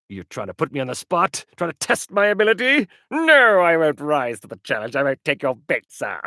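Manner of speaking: hammed-up defiance, building to a high, loud refusal; mock indignation. Streamer refusing a viewer's improv challenge, hammed-up indignation. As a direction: play offended and theatrically refuse the dare.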